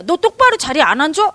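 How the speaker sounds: loud, sharp scolding at a restless pupil; angry teacher. Retold or imitated. Teacher angrily scolding a pupil who wanders in class, loud. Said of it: "화를 내요".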